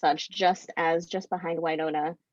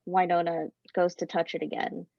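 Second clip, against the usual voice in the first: ordinary delivery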